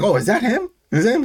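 lightly mock-excited questioning. Natty asks about Rick.

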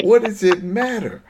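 high-pitched, baffled protest; exasperated confusion. Retold or imitated. Viewer pausing the show to protest, loudly baffled. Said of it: "I'm confused too".

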